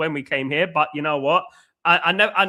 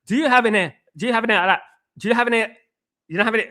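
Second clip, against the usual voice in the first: higher put-on Geordie, repeating haltingly; Anthony; 4 semitones higher, 3 dB louder, much faster than usual. Mimicking the man at the window asking the lads, in a Geordie accent. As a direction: imitate a Geordie asking on the sly. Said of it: "I don't know if this is a good Geordie accent".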